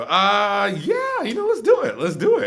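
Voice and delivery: very high, loud enthusiasm; acted-out excited yes with a laugh; retold eager acceptance. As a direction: jump at the invitation, whooping it up. Acts out eager acceptance of invitation.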